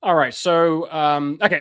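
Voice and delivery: ordinary delivery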